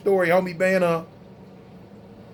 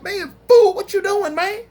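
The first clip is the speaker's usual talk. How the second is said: angry outburst; 12 semitones higher than usual. Retold or imitated. Man waking up yelling at the cop over the stick, mock outburst. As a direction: snap awake and yell at the cop. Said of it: "I'm like".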